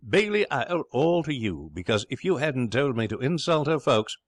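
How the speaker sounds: high, excited gratitude; rapid, elated. Acted. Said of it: "he went on rapidly".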